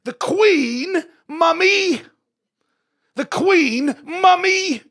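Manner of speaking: high, loud British proclamation; British royal shriek. Acted. Believer answering the inner doubter, shrieked British retort. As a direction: cry it out in drawn-out British tones. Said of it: "It sounds better in a British accent".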